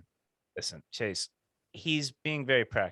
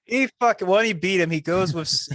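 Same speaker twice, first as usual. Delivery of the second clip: louder, higher burst of real excitement; excited outburst; 7 semitones higher, 8 dB louder than usual. Real, not acted. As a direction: jump in fired up. Excited outburst about the fight.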